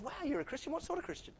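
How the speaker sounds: high-pitched, hammed-up wonder; thrilled joke character, pitched high; excited questioning.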